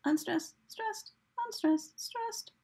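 high-pitched demo of alternating "unstressed" and "stressed" beats; alternating stresses. Shown as a demonstration. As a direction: chant "unstressed, stressed" high and light, as a steady two-beat pattern. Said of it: "like this".

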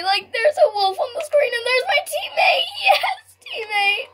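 giggling through the words, voice cracking up; laughing and half crying. Real, not acted. Streamer overjoyed at her teammate on screen, laughing. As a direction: laugh helplessly while pointing things out. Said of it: "I'm laughing and half crying".

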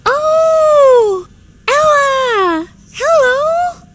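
high, delighted phone hello; effusive high-pitched welcome; gushing greeting. Acted. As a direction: sing out a greeting to a friend.

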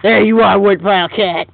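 very low growly villain voice; menacing dog villain. Acted. Dog villain plotting against the cat, very low menacing voice. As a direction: drop way down like a brute dog.

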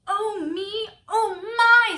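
frightened Square. A narrator's reading. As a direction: cry out as if scared of a snake. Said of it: "Square was afraid of snakes".

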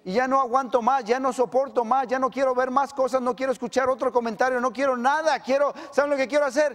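hungry man listing what he can't stand; exasperated rant. Acted. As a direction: pile it up, fed up.

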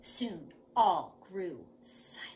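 slow, low, half-whispered narration; hushed. A narrator's reading. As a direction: let the words sink into silence. Hushed night scene in story.

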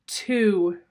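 loud countdown announcement in a lightly put-on computer voice; blaring computer voice. The contraption's speaker starts the countdown, lightly robotic and loud. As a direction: blare out the count like a machine. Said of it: "A scratchy computer voice blared".

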